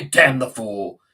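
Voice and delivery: loud curse in Heathcliff's voice; sudden loud outburst; exasperated cry. Acted. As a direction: curse the approaching husband. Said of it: "cried Heathcliff".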